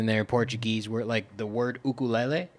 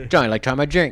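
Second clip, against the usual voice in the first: loud, higher, loose-jawed Hawaiian pidgin; open-jawed Hawaiian pidgin; 5 semitones higher, 9 dB louder, faster than usual. Shown as a demonstration. Showing a friend how pidgin goes, loud open-jawed Hawaiian pidgin. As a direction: drop the jaw and fling out the pidgin. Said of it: "open your jaw a little bit more".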